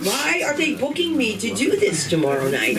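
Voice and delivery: ordinary talk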